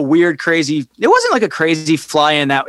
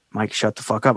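low, soft aside through the moment; urgent under-the-breath shushing, acted out; hushed warning; 9 semitones lower, 8 dB quieter than usual. Storyteller nudging his friend to stop, hushed warning.

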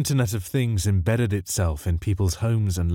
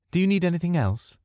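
much higher, sweet hostess voice; house mimicking old lady; 10 semitones higher than usual. A narrator's reading. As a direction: fuss over the guests as the house. House voice asking guests.

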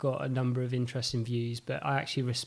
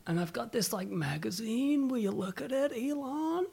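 stoner voice; 10 semitones higher than usual. Retold or imitated. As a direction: drift up high and dazed. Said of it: "I'm really high right now".

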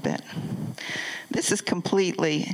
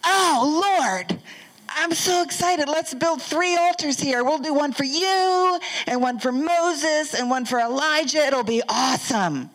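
high, gushing excited voice; excited Peter; 7 semitones higher than usual. Retold or imitated. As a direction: bubble over like eager Peter. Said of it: "he's so excited".

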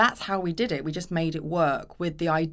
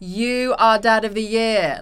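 slow, loud, higher blokey back-slap; hamming up a matey cheer; mock cheering a husband as a hero; hearty mock praise; 5 semitones higher, 8 dB louder, slower than usual. Mocking praise given to husband.

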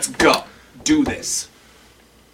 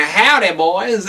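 higher, loud southern twang greeting; 6 semitones higher, 3 dB louder, slower than usual. Acted. Co-host demonstrating how Mudd greets the miners, southern twang. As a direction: lay on a playful southern twang. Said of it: "put a little southern twang on it".

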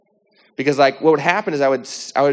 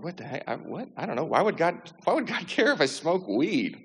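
hushed, higher-pitched retold protest with a laugh; flustered, softer, questioning why it matters; dismissive bafflement; 5 semitones higher, 5 dB quieter than usual. Answering the voice about smoking weed, baffled and dismissive.

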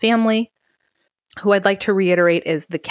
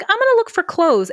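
mocking high voice; 13 semitones higher, 3 dB louder than usual. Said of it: "so she's like".

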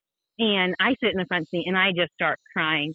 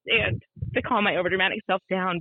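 ordinary talk

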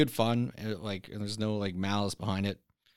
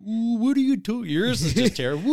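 high, hammed-up Canadian accent; 11 semitones higher, 4 dB louder than usual. Shown as a demonstration. Friend performing on request, mock Canadian accent. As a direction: pitch it way up, exaggerated Canadian. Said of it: "you say the Canadian accent".

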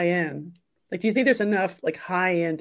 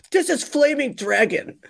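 mock movie yell in an accent, pitched high; imitated yell; 8 semitones higher, 3 dB louder than usual. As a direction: imitate an accented shout, higher than usual. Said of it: "Tom Cruise is yelling at him".

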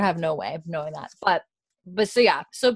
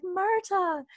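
imitated high-pitched disbelief; retold stunned greeting, voice pitched way up; Claire, amazed; 12 semitones higher than usual. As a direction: call the name in astonished recognition. Claire stunned to see old friend.

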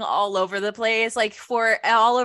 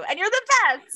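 touched aw; 12 semitones higher, faster than usual. Real, not acted. Sweet compliment, high aw.

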